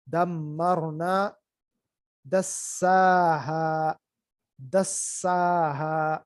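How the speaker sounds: دمرنا and دساہا very slow, with weight on the doubled مّ and سّ; دمرنا with doubled مّ held, دساہا with doubled سّ held; stress on the doubled letter. Shown as a demonstration. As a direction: lean hard on the shadda in دمرنا and دساہا. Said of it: "اس پر اصل میں زور آتا ہے".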